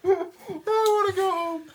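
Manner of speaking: very high, slow pitiful whine; whiny high voice. Acted. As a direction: whimper like you want out. Labor act, whiny high voice.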